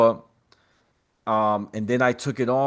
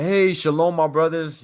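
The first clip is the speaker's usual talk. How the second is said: bright, raised-pitch friendly hello; cheerful greeting; 6 semitones higher than usual. Retold or imitated. Cheerful greeting to yeshiva boys.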